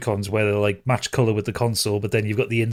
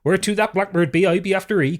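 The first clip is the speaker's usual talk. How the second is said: put-on West Country accent; 9 semitones higher than usual. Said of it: "they talk like that".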